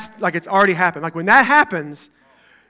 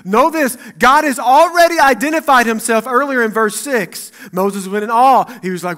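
ordinary talk, nothing marked

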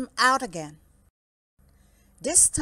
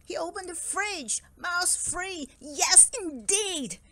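gleeful relief; 8 semitones higher than usual. A narrator's reading. Bear's relief at a mouse-free fridge.

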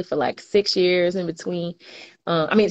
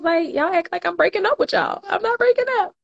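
playful protest; 13 semitones higher than usual. Real, not acted. Teasing viewers about leaving the live.

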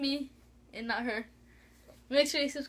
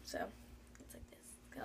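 whispering; 24 dB quieter than usual. Real, not acted. Hushed before her attempt.

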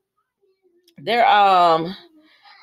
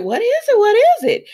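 high, eager demand to know; excited imitation, voice jumping high and louder at the end; retold eager excitement; 6 dB louder than usual. Daughter begging her parents for their news, retold excitement.

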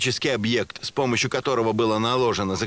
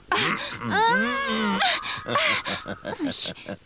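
laughing. Acted. Rogue laughs, leaving them.

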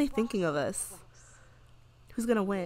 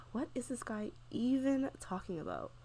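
soft, weary sigh into the question; bored; 2 semitones higher, 9 dB quieter than usual. Acted. Player voicing her bored Sim during opponent's speech, bored. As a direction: sigh and sound bored out of your mind. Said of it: "She's so bored. She's like".

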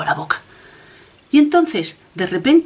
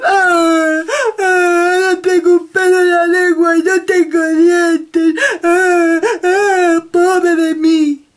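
loud, higher hammed-up wailing; crying wolf; 9 semitones higher, 5 dB louder, much slower than usual. Wolf wailing after losing his teeth, loud hammed-up crying. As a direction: blubber like a toothless wolf, overdone. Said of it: "El pobre lobo empezó a llorar".

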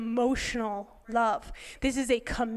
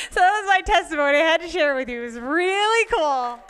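loud, higher, gushing finish; real excitement; 5 semitones higher, 10 dB louder than usual. Real, not acted. Speaker closing her testimony to the church, thrilled. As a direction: wrap it up bubbling over.

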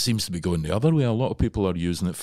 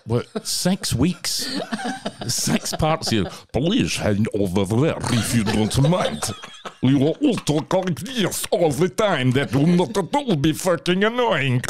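slow, higher, louder mock Russian accent; 9 semitones higher, 4 dB louder, slower than usual. Mocking a reviewer, talking in a hammed-up Russian accent. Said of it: "that silly voice".

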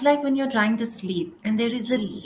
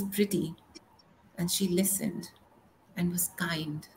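barely voiced, softer, grieving; whisper; 8 dB quieter than usual. Real, not acted. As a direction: let it fall to a whisper. Tender memory of murdered boyfriend.

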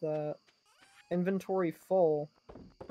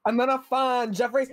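louder, higher chant; excited sing-song; 6 semitones higher, 6 dB louder than usual. Pretend. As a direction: chant it gleefully.

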